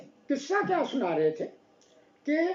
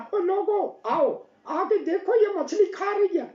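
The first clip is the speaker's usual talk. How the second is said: raised, higher call to the villagers; shouting for help; 7 semitones higher than usual. A narrator's reading. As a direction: yell out to the neighbours. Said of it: "اس نے پھر شور مچایا نا". (گاؤں والوں کو اونچی آواز میں پکارنا)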